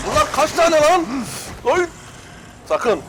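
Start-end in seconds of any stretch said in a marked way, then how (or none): 0.1-1.9 high, loud alarmed cry; alarmed shout. Real, not acted. Gamer shocked at the size of the horde, shouting.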